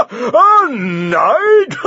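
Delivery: mocking champion. The champion jeers at the knight, scornful bellow. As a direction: sneer it out like a bully.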